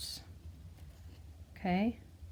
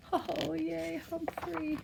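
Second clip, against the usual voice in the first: little cheer; 8 semitones higher, 4 dB quieter, slower than usual. Real, not acted. Reader cheers for whale.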